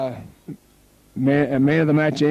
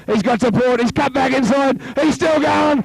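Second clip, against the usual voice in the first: building excitement; 10 semitones higher than usual. Real, not acted. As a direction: ride the break up to a yell. Live try call building.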